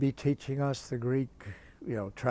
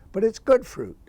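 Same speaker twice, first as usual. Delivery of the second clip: higher and louder burst; excited punchline; 10 semitones higher, 11 dB louder than usual. Real, not acted. Speaker relishing the forbidden books, higher and louder. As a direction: punch out the delight.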